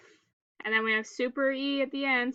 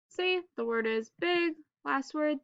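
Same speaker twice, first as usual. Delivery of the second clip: usual speaking voice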